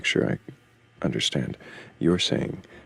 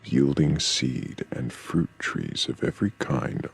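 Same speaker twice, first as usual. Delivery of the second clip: scripture reading. Acted. Reading Genesis passage aloud.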